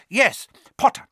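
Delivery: high, loud summons; commanding villain. A narrator's reading. Villain rounding on the boy, loudly commanding. Villain turns on his captive.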